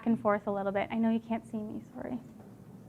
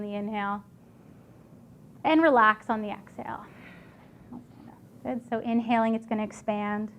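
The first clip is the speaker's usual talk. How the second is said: guided breathing; 2 semitones lower, 5 dB quieter than usual. Acted. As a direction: calmly guide the breath, softly.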